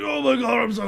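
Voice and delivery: high, loud imitation of stoned wrestlers losing it; mock freak-out. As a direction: act like they're totally blown away. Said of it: "lose their minds on it".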